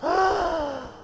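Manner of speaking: sharp shocked gasp. Retold or imitated. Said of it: "And then I was like".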